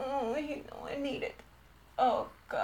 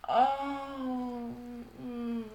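ordinary talk

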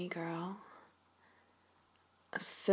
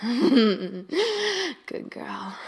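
proud, louder and drawn out; loud praise; 3 semitones higher, 9 dB louder than usual. Acted. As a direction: declare it with satisfaction. Loud praise after laugh.

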